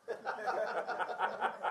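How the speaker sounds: quiet unvoiced chuckle; a breathy laugh at his own joke; soft breathy laugh. Real, not acted.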